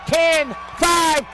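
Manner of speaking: excited shout. Real, not acted. Radio announcer calling the touchdown return, shouting. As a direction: scream it as he breaks free. Kickoff return run called live.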